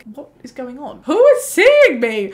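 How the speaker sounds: high, loud and drawn out; exasperated outburst. Retold or imitated. Venting to herself after an hour of waiting, high loud exasperation. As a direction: throw up your hands, slowly. Retold exasperation at long wait.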